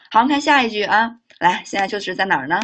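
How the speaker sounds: ordinary talk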